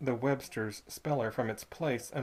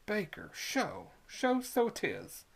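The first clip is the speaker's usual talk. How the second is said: put-on queer drawled accent; 7 semitones higher, much slower than usual. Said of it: "with the queerest accent in his voice".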